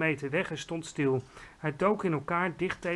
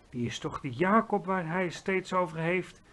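plain reading voice

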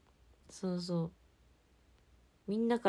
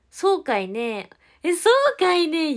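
catchphrase demo; 8 semitones higher, 11 dB louder than usual. Shown as a demonstration. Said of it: "言うかもしれん".

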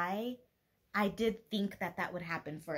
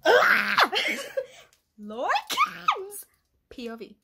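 high, loud laughing shriek; laughing squeal; 10 semitones higher, 10 dB louder, much slower than usual. Real, not acted. Fangirling to the camera over Lorcan's point of view, laughing shriek. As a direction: squeal it through a laugh, thrilled.

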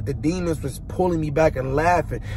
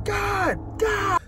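retold scream; 3 dB quieter than usual. Retelling his screaming to God in hell, high mock scream. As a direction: re-enact screaming upward in a high voice. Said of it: "I just got to screaming".

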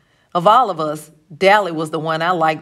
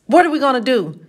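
higher, agitated plea; worried outburst; 7 semitones higher, 4 dB louder than usual. A narrator's reading. Johnny pacing, slapping his pockets, agitated. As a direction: ask it high and at a loss. Frightened boys in hiding, pacing.

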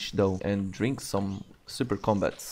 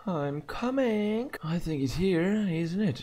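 high put-on voice; 8 semitones higher than usual. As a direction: play a squeaky little character voice. Mock character voice while playing.

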